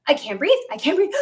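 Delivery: re-enacted breathless alarm; retold panic. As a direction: act out panicking for air. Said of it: "I'm, like, panicking".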